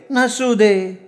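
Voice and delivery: high, put-on woman's voice; coaxing sweetheart. A narrator's reading. Woman dismissing his lack of swimwear, high coaxing. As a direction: brush it off in a high pitch.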